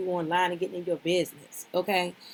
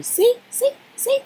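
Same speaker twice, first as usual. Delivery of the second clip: high, loud teasing see; gleeful gotcha, much higher and louder; excited see; 9 dB louder, slower than usual. Real, not acted. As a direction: crow it like you caught them out.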